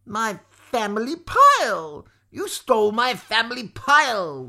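seethe loudly, stretching the accusation; seething. A narrator's reading. Maudmont finding the pebbles are their own, loud seething rage. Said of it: "Maudmont seethed".

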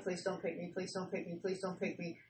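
soft, repeated please don't pick me under the breath; anxious pleading murmur. Retold or imitated. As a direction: murmur it like a nervous prayer. Praying not to be picked.

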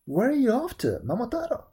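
high storybook bird; pheasant voice. A narrator's reading. Pheasant questioning Momotaro on the road, high bird voice. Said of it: "said the pheasant".

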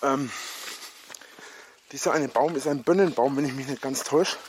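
ordinary talk

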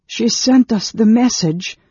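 high, loud and distraught; anguished protest. Acted. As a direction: protest loudly through grief. Distraught friend argues back in grief.

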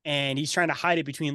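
normal talking voice

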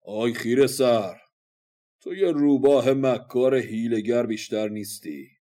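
loud, scornful battle cry; shouted retort. Acted. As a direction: shout the insult at a rival warrior. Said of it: "فریاد زد".

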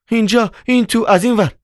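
flustered, high-pitched directions; hurried. A narrator's reading. Wife shows the visitors the way, hurried and high. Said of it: "با عجله گفت".

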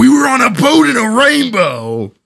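high mock yell; retold yell. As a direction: shout it up high like a crazy person. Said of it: "I was yelling at him".

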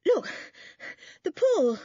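breathless, high excited bursts; panting. A narrator's reading. Boy showing the others the pool, panting. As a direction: gasp it out between breaths. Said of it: "he panted".

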